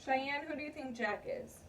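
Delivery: usual speaking voice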